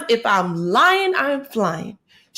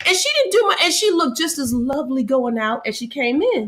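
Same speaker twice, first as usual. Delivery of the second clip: high excited; 7 semitones higher than usual. Real, not acted. Still laughing, excited recounting.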